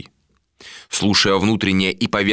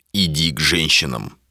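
lower, lightly voiced male order; understated curt captain; 4 semitones lower than usual. A narrator's reading. Captain dismisses the woman, lightly voiced low order. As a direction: send her away in a low voice.

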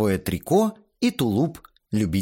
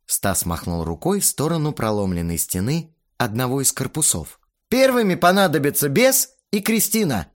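put-on higher, offhand young director; casual director voice; 9 semitones higher, 4 dB louder than usual. A narrator's reading. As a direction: explain the shot loosely. Stas's lines voiced.

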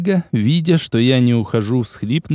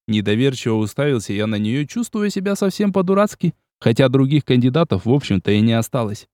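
usual speaking voice

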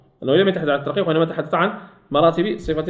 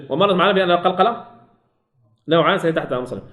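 normal talking voice

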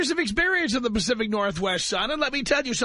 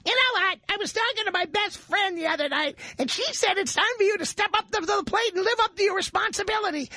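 high mock girlfriend voice; hammed-up nagging girlfriend; 10 semitones higher than usual. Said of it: "at some point she's gonna say".

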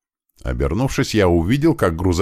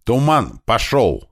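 high-pitched acted yell; yelled radio order; 6 semitones higher, slower than usual. Leader into the radio over gunfire, high-pitched yell. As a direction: send him off with two punched words. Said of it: "заорал я в рацию".

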